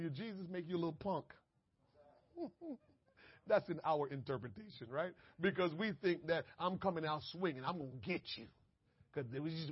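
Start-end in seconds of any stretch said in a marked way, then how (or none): none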